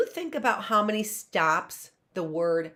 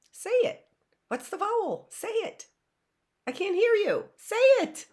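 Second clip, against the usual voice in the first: eager coaxing; faster than usual. Acted. Teacher coaxing kids to answer.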